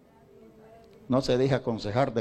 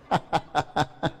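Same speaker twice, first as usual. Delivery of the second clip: short laugh; 4 semitones higher than usual. Real, not acted. Preacher laughs at story.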